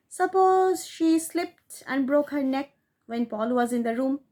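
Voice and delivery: ordinary delivery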